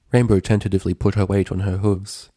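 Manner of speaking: usual speaking voice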